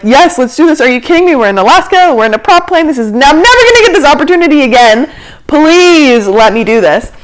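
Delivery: high, excited pleading; super stoked. Retold or imitated. Passenger urging the group to go weightless, super stoked. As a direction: beg eagerly, stretching the final plea. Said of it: "I'm super stoked. I'm ready".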